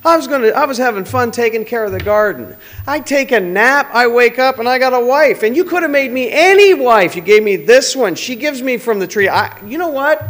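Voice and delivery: high, loud indignant complaint; Adam blaming everyone. Acted. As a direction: rant at God like a wronged man.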